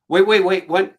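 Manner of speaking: loud, high protest; mock panic. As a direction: blurt it out to stop the push, acted. Acts out hesitation before bungee push.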